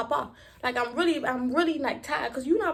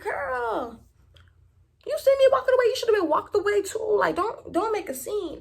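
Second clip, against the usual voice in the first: retold scolding; 7 semitones higher than usual. Retells scolding Luna for pulling her.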